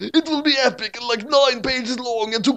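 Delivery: high, slow movie trailer announcement; mock trailer voice. Author plugs the next story, high slow trailer voice. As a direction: hype it like an ad.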